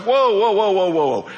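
loud, high mock objection from the older brother; a hammed-up older sibling stopping everything; indignant protest. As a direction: throw up the hands and cry foul. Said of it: "the older brother's like".

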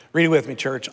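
ordinary delivery

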